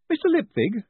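put-on high, bright young woman's voice. A narrator's reading. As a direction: greet him brightly and briskly. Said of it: "she said brightly".

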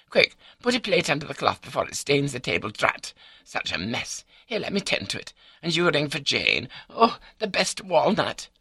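high-pitched wife fretting over a spill; fussing wife. A narrator's reading. Voices the wife in story.

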